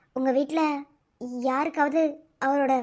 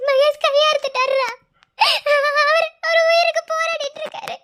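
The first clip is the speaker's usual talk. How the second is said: distressed news; 6 semitones higher, 5 dB louder than usual. Acted. Breaking news of brother's injury.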